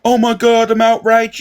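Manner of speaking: high, loud mock-outraged gasp; mock outrage. Mocking fake-outraged people on a live stream. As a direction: ham up fake shock, hands in the air. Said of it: "throw their hands up in the air and go".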